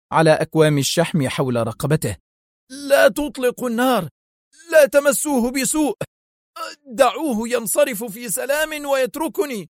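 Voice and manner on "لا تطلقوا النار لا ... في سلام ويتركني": much higher, panicked fat man begging; terrified choking plea. A narrator's reading. Ventura, choked by Adham, begs his men in high terror. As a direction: squeal for mercy while being choked. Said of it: "هتف فينتورا في رعب وهو يختنق".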